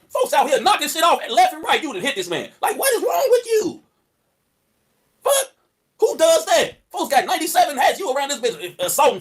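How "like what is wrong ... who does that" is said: high, slow outraged shout. Real, not acted. Reacting to the video of a woman hitting a man, a high outraged shout. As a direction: yell it in disbelief.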